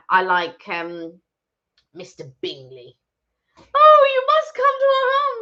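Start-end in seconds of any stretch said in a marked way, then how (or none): 3.6-5.4 very high, loud, hammed-up society hostess; over-the-top Jane Austen matron, pitched way up; gushing period-drama character. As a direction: gush an invitation in a piping put-on voice. Mimics film character greeting Mister Bingley.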